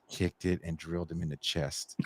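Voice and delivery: ordinary talk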